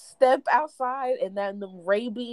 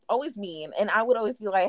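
normal talking voice

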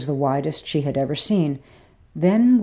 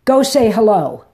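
loud, sharp bark from a much higher pitch; barked order; 6 semitones higher, 9 dB louder than usual. A narrator's reading. Madam barking at a worker to go greet a client, loud. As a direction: snap it out like a brisk madam giving orders. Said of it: "Sandra barked".

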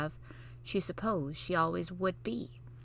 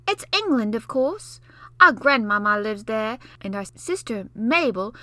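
put-on know-it-all boy; 2 semitones higher, 7 dB louder than usual. Basil lecturing Mary, know-it-all scorn. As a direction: lord it over her.

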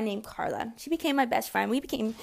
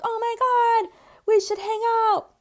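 mock squeal, much higher and louder; excited gush; 12 semitones higher, 6 dB louder than usual. Two new friends squealing about hanging out, excited and high. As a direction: gush like you just found your new best friend.

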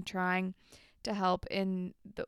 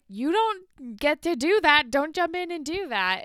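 louder, higher scolding; freaking out; 7 semitones higher, 8 dB louder than usual. Retold or imitated. Andrea scolding Paige for jumping in, louder scolding. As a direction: slap her down for butting in. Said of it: "Andrea rightfully freaks out".

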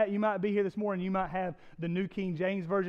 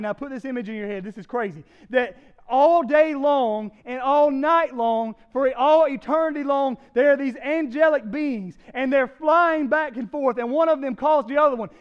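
louder, higher preaching, rising in intensity; full-force preaching; 7 semitones higher, 9 dB louder than usual. Acted. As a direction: preach with mounting fire to the congregation.

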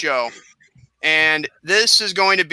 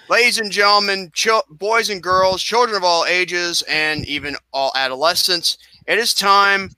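ringmaster; 5 semitones higher, slower than usual. Acted. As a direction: announce the main event like a ringmaster. Announcing the main event.

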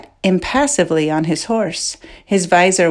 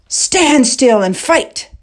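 high, slow furious roar; roaring tyrant king; 7 semitones higher, slower than usual. Acted. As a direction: bellow it at the dodging knight. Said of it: "the king roared".

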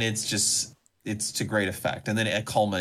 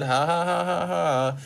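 1 mock laugh; 5 semitones higher, slower than usual. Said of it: "Like, you get it".

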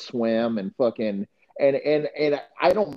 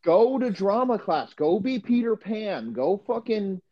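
mock rant; 7 semitones higher, slower than usual. Real, not acted. Ranting at slot receivers.

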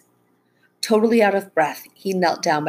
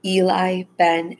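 ordinary delivery

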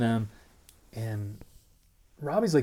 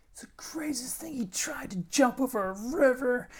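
much higher, half-whispered, buzzing; breathless excitement. Retold or imitated. Telling his new girlfriend about the jump, breathless and buzzing. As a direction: gush it out, still amazed.

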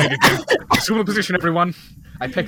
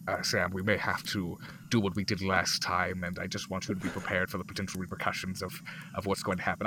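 hushed aside; 7 semitones lower, 9 dB quieter than usual. Acted. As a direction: take an ally aside, voice well down. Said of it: "pull Sam, uh, to the side".

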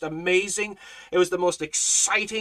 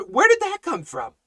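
higher-pitched, surprised question; puzzled amazement; 10 semitones higher, 3 dB louder than usual. Acted. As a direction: ask it as if caught by surprise. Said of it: "and you're saying".

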